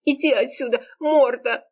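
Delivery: high, tearful outburst; breaking-voice exclamation. A narrator's reading. Serafima chases Kurakin off, voice breaking. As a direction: send him away in a high, cracking cry. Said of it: "срывающимся голосом воскликнула".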